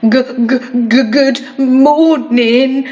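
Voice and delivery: slow, teeth-chattering stammer from the cold; shivering stutter. Acted. Frozen postman stammering a greeting to Miss Sunshine, shivering. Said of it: "shivered the frozen postman".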